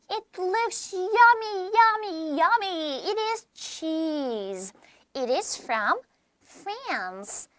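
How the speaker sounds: slow, carefully spaced words; slow model reading. Shown as a demonstration. Teacher shows students how to read the lines, slowly. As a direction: read slowly for learners to copy. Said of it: "여러분들 요렇게 봐주면 되고요".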